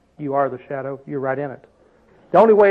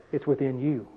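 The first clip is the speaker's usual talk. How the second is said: hushed, much softer and low; low, soft divine whisper; quiet voice of God; 6 semitones lower, 12 dB quieter than usual. Retold or imitated. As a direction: say it softly as God's voice. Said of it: "And he said".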